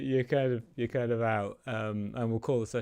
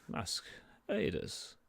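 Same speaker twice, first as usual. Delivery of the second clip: quiet, slow incantation; a hushed thief casting an invisibility spell; Torvil's spell words; 9 dB quieter, slower than usual. Acted. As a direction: murmur the spell softly and slowly. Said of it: "and kind of says".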